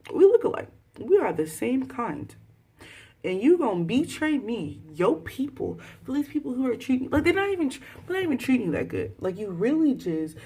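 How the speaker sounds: high-pitched, loud, worked-up scolding; exasperated rant, voice pushed high; indignant confrontation. Pretend. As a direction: call out a traitor in disbelief. Addresses the traitor indignantly.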